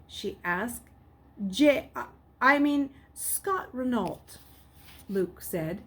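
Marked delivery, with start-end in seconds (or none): 1.4-4.4 louder, higher slip, then slow false name; boy faking a name under pressure; caught stammer. Acted. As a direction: stumble and cover with a fake name. Luke caught, fakes a name.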